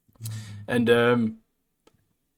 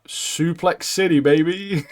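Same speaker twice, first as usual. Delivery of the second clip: hyped catchphrase; 6 semitones higher, slower than usual. Retold or imitated. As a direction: stretch it out like a hype line. Reads out own note as catchphrase.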